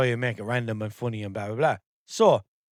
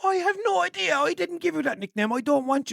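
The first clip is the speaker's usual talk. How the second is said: very high, exasperated protest; exasperated denial; 13 semitones higher than usual. Real, not acted. Podcaster denies naming his AI assistant, exasperated. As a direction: pitch it way up in baffled protest.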